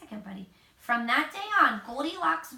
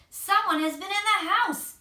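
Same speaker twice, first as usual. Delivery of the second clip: higher, louder alarmed exclamation; exclaiming; 8 semitones higher, 6 dB louder than usual. A narrator's reading. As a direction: sound the alarm to the family. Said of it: "he exclaimed".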